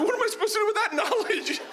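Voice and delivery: very high, squeaky protest; exasperated disbelief. Retold or imitated. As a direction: squeal in indignation at useless advice. Exasperated reply to useless advice.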